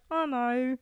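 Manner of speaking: British baker. Retold or imitated. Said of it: "And she's like".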